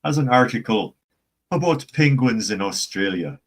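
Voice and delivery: put-on American-style Irish accent. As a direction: read the article in a stagey Irish accent. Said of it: "That's the American Irish".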